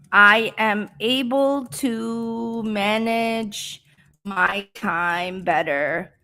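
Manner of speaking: very slow, word by word with long pauses; slow speech. Shown as a demonstration. Teacher building a model answer for English learners, slow speech. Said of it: "I'm speaking slow".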